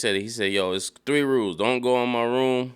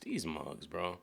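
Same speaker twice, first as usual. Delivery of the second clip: low disgusted mutter; 5 semitones lower, 13 dB quieter, slower than usual. Real, not acted. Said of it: "I'm like".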